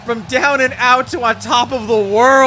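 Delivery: high, loud, slow; dramatic closing call. Real, not acted. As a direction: sum up the night in triumph. Closing call after the win.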